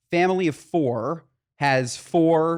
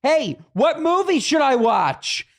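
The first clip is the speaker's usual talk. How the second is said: higher, louder call over the seats; 11 semitones higher, 4 dB louder, slower than usual. Retold or imitated. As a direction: call back across the rows, slowly. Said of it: "She'd turn and she'd say".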